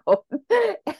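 short high-pitched laugh. Real, not acted. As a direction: burst into a squeaky laugh. Laugh after the story.

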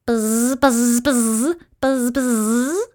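bursts of bee buzz, rising; swarm buzzing. A narrator's reading. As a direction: buzz like twenty bees. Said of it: "said twenty bees".

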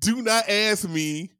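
drawn out, high and loud; pleading in mock despair; slow emphatic plea. Real, not acted. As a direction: stretch every word.

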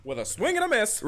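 mock play-by-play call; sports announcer.

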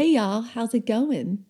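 light, lilting Southern-accent greeting. Shown as a demonstration. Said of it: "Do your Southern accent".

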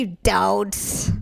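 slow, deadpan comeback; slow flat retort. Retold or imitated. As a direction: drag it out, unconvinced. Wife retorts flatly.

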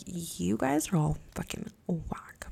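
slow, unvoiced scorn; whispered verdict. Judging the cops, in a slow whisper. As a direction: whisper it with contempt.

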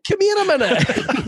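high, slow, laughing menace; mock Glasgow threat. Comic on Scots phrases, slow mock menace. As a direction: ham up a friendly threat. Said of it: "Only in Scotland can that phrase be a threat".

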